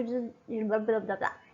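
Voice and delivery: real verbal stumble, dropping into babble; tongue-tied babble. Real, not acted. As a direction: trip over the words into low blah-blah. Stumbles over words, then apologises.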